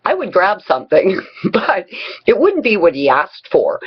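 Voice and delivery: normal talking voice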